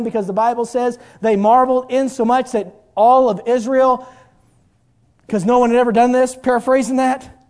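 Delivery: loud, driving preacher's voice from the pulpit; full-force preaching. Acted. Preacher pounding home the sermon to his church, loud and fervent. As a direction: preach it hard and loud to the congregation.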